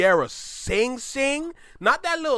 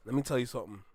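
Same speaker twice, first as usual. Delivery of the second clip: much lower and softer, leaning in; low and serious; 6 semitones lower, 8 dB quieter, much faster than usual. Real, not acted. Telling the artist something important, low and hushed. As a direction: drop the voice to make a point.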